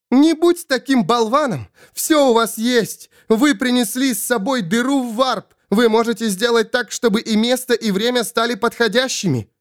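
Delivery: high-pitched urgent scolding; high, frantic argument; exasperated Svol. Acted. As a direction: berate him in a high, pressing voice. Svol berates Ravenor desperately.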